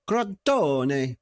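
Crotone said with a hammed-up Italian accent; playful put-on Italian accent; mock Italian accent. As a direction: roll it out like an Italian commentator. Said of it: "I've got to stop doing the accent".

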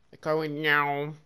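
a loud playful cat meow; mock meow. Teasing a friend on voice chat with a loud mock meow. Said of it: "laugh at my meow".

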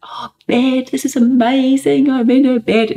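high, thrilled imitation of a child; delighted child. Mother retelling her son coming inside, delighted and excited.

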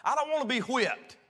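hammed-up frantic pleading, high and loud; mock begging. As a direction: beg like a child about to be whipped. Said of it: "can't even say the word spanking".

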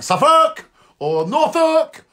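"Suffolk" and "Norfolk" with second syllables lifted high; demonstrated pronunciation. Shown as a demonstration. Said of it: "you gotta say it like".

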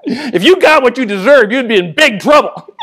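laughing through the words. Real, not acted. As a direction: say it while laughing.

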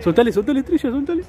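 agudo y apurado; urgencia. Real, not acted. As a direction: pide soltar línea, nervioso. Pesca en pleno tirón. ("soltale" agudo y urgente)

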